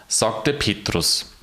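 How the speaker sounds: ordinary delivery, nothing marked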